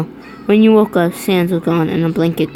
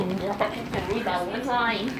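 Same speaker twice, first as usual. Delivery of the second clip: softer aside; 3 semitones higher, 12 dB quieter, much slower than usual. Real, not acted. Aside while reading fanfic.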